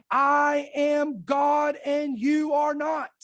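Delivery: slow, weighty voice of God; God's rebuke. Retold or imitated. Preacher voicing the Lord inside his spirit, slow divine rebuke. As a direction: declare it word by word with sovereign authority.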